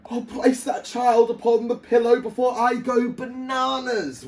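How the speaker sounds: high, loud desperate wail. A narrator's reading. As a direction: wail it like a frazzled king. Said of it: "wailed the king".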